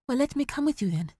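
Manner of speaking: a hint of a pleading son; eager boy. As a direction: ask to tag along. Boy voice asking father.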